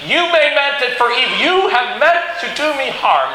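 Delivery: high, emphatic preaching pitch; forceful declaration. Acted. Joseph confronting his brothers over their harm, emphatically.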